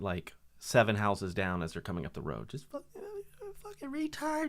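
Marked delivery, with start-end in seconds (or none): 2.5-4.5 higher squeaky mock shriek of a fight; mock screaming couple. Neighbor imitating a couple fighting in the truck, higher mock shriek. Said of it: "hear 'em fighting".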